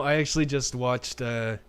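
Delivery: normal talking voice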